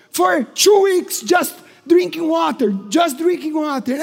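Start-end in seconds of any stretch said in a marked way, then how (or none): none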